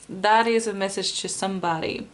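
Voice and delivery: ordinary talk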